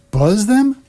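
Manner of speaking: surprised echo. Real, not acted. Listener echoes a baffled phrase.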